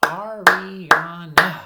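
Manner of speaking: Ariana very loud, in four separate beats; clapped syllables. Shown as a demonstration. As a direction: clap out A-ri-a-na slowly. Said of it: "Let's clap it".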